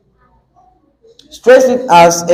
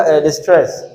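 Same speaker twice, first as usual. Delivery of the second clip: usual speaking voice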